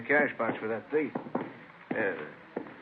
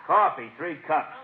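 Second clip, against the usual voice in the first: calling out an order; 5 semitones higher, 5 dB louder, slower than usual. Acted. Orders coffee across the room.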